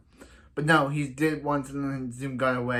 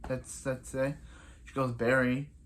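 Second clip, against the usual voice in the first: ordinary talk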